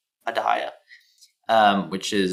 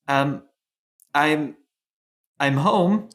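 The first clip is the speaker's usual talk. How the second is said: halting, higher, rising on the last word; hesitant announcement; 6 semitones higher, slower than usual. Acted. Enora to her family at the door, hesitant. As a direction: tentatively announce yourself, stopping and restarting. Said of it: "clears her throat and she's like".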